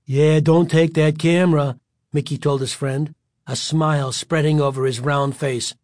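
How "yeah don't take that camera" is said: smug teasing bully; louder mocking tough kid. A narrator's reading. Bully voiced in story.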